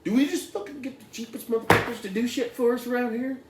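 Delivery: high, loud, hammed-up fury; mock outrage. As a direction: rant like you found a botched job. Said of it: "And we're like".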